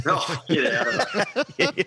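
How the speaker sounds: slow, laughing protest. Real, not acted. Laughing off a teasing remark.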